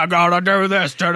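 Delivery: a cranky fan muttering his plan; angry nerd voice. Acted. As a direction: grumble it like an impatient nerd. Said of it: "all of my nerd voices are angry".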